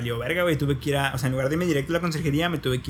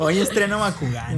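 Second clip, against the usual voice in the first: mock proud kid; 3 semitones higher, slower than usual. Kid showing off stolen toy.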